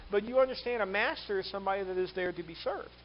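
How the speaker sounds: normal talking voice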